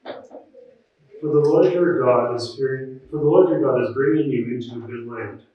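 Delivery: ordinary talk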